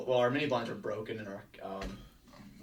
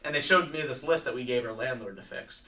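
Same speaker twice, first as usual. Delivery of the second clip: normal talking voice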